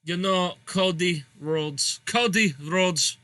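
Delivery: slow, drawn-out attempt at a German accent; bad German accent. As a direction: do a shaky-at-best German accent, drifting towards Swedish. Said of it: "I'm very bad at my German accent".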